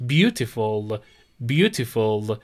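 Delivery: Beautiful with the stress on BEAU; stress on first syllable. Shown as a demonstration. Teacher shows students the stress of Beautiful, first syllable. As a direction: hit BEAU in Beautiful, rest light. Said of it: "استرس روی ... سیلاب اول".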